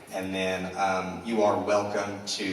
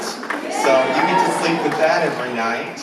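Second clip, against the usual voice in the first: loud; 7 semitones higher, 8 dB louder than usual. Real, not acted. Cheering at award giveaway.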